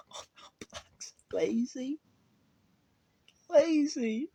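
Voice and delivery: whispered laugh, then a high squeak; laughing disbelief. Real, not acted. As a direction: laugh through the words, incredulous.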